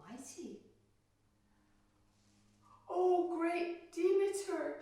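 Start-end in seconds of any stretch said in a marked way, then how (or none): 2.9-4.8 high, loud, slow cry of awe; awed plea. Acted. Frightened queen to the goddess, a high, loud, slow plea. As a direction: fall before the goddess crying out. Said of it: "she said".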